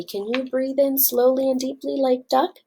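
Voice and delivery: plain reading voice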